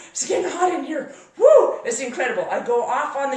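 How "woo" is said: high, loud joyful whoop. Real, not acted. Speaker relives the off-road thrill, loud whoop. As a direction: let out a whoop of joy.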